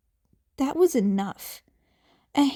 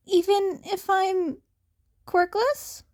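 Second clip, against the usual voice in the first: put-on higher, unsure teen voice; hopeful teen; 7 semitones higher than usual. Izuku doubting himself to Aizawa, higher. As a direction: ask hesitantly, pitched up.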